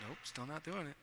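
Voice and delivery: very soft, low mumbled refusal; sleepy refusal. Retold or imitated. Pretending to sleep through Casey's calls, a soft low grumble. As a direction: grumble it under your breath, half asleep. Ignoring Casey half asleep.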